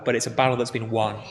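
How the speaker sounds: normal talking voice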